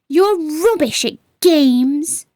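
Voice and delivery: ordinary delivery, nothing marked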